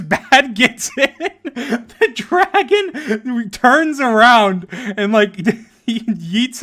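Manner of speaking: high, slow, through laughter; can barely get it out for laughing. Real, not acted. Laughing at the dragon tossing his friend, drawn out through laughter.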